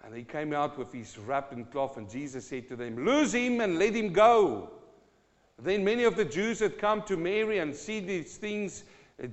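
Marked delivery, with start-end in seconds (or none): 3.0-4.7 commanding call. Acted. Jesus's command acted out in reading.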